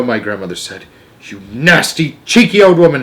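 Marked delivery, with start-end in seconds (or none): 1.2-3.0 outrage building to a high, loud peak; angry shout. A narrator's reading. Father scolding the grandmother, angry shout. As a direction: swell into an indignant shout. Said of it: "shouted Mister Jenkins".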